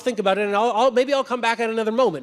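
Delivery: high, loud, nervous excuses; stalling ghost. Acted. Ghost stalls the angel with excuses, high and nervous. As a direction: talk your way out of it, anxiously.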